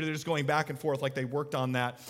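ordinary talk, nothing marked

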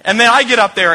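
ordinary delivery

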